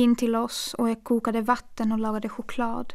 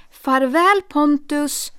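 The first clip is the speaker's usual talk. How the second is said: louder, higher melodramatic goodbye; hammy stage farewell; 5 semitones higher, 7 dB louder, much slower than usual. Acted. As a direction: overact the farewell so she hears it. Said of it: "ännu värre än teater". (överdrivet teatraliskt farväl)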